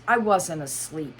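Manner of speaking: usual speaking voice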